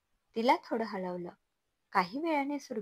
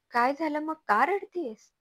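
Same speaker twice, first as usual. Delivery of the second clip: anxious questioning; 7 semitones higher, 6 dB louder than usual. Acted. Mother-in-law still alarmed.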